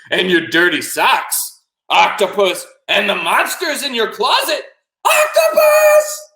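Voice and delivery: rising from loud to high scream; building to a shout. Acted. Boy lists silly good nights, building to shout. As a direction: escalate each item to a final yell. Boy's bedtime teasing builds.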